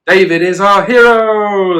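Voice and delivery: joyful rallying cry; crowd shout. Acted. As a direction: shout it as the cheering army. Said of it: "they shouted".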